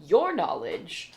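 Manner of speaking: high, bright MC voice booming a welcome; game show host. Acted. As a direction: announce it like a pub quiz MC. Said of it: "He's like".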